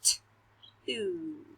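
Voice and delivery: T and oo said as separate sounds; sounding out words. Shown as a demonstration. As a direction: segment the sounds apart. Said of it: "Listen to these sounds".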